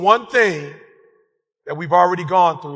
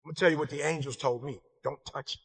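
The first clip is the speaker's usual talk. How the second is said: wife's hushed, grave advice, sinking softer; quiet warning; 4 semitones lower, 8 dB quieter than usual. Retold or imitated. Wife quietly warning her husband not to touch it, hushed. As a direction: lean in and caution him gently and low. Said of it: "She said".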